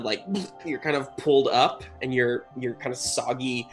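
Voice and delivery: normal talking voice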